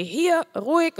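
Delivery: commanding call. Retold or imitated. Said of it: "ich muss doch eine laute Stimme".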